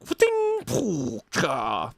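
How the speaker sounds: clang and whoosh noises by mouth; playful mouth sound effects; weapon sound effects. Acted. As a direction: make the clash of steel with your voice. Imitating clashing weapons.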